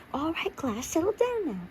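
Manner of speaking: high, sing-song call to settle down; teacher calling class. A narrator's reading. Teacher quieting the class at lesson start, high sing-song call.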